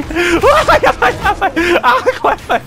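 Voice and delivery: ordinary delivery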